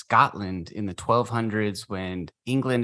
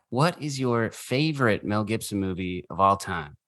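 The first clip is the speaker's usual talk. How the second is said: usual speaking voice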